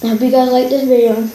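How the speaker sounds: eating ice cream sandwich; chewing while talking. Real, not acted. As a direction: mouth partly full. Seen 0: Holds ice cream sandwich, hand at mouth, lips chewing between words.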